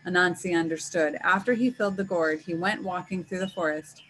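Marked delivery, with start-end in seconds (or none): none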